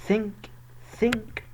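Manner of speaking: "سينك" high, starting on a plain s; pronounced with S. Shown as a demonstration. Teacher showing the mistake to avoid, "سينك" high with an s. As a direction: say "سينك" as sink, with s not th. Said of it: "بالسين".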